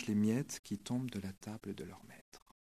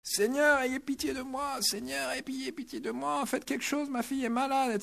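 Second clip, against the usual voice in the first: much higher, wailing plea of a desperate mother; pleading cry; 14 semitones higher than usual. Retold or imitated. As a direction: cry out to the Lord in a high-pitched begging wail. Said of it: "elle se met à hurler".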